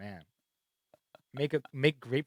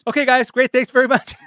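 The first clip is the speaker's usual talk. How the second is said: high, loud announcer sign-off; booming host wrap-up; 11 semitones higher, 14 dB louder than usual. Acted. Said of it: "Oh, I got called out".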